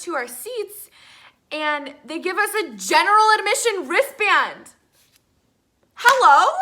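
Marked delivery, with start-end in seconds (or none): 6.0-6.5 loud, high incredulous hello. Retold or imitated. Fan reacting to the wrong wristband, incredulous. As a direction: burst it out in total disbelief.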